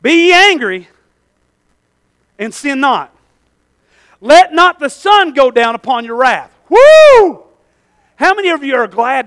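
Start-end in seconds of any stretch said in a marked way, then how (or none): 0.0-6.5 loud, high punchline of the verse; proclaimed scripture. Shown as a demonstration. Preacher springing the verse on his congregation, loud and high. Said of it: "No, I'm sorry". 6.7-7.4 high, loud preacher's whoop; joyful whoop. Real, not acted. Preacher whooping as the verse lands, high and loud.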